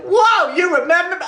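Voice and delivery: high-pitched, amazed mock reaction; amazed viewer. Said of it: "Jeremy's like".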